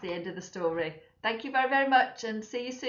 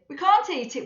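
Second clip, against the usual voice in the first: sternly; 9 semitones higher, 7 dB louder than usual. Acted. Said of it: "says Mum sternly".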